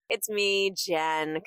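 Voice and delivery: ordinary talk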